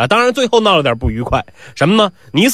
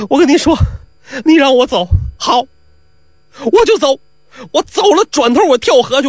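high, pitched-up woman threatening to drown herself; quarrelling wife threatening to jump in the river; wife's threat; 7 semitones higher than usual. Acted. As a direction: fling it at him, high and wounded.

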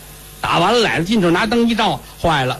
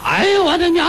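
high sudden yelp of pain and joy; mock yell; 12 semitones higher than usual. Toad-man yelps while kissing the stump, high yell. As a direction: cry out high, mid-kiss. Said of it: "一边啃一边喊".

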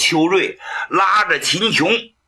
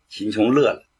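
ordinary delivery, nothing marked

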